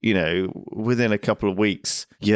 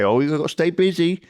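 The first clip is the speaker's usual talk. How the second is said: higher voice, a touch of mock cheer; quoting common advice; 8 semitones higher than usual. Quoting what people tell you after a breakup, higher and mock-cheery. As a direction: parrot the usual advice. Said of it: "that's one thing they always say".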